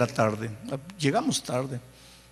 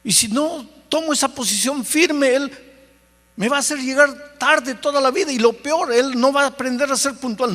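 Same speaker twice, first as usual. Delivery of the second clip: heated self-justification; 8 semitones higher than usual. Retold or imitated. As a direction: insist you are right. Pastor argues his firm position. (pastor discute con el espíritu, agudo y fuerte)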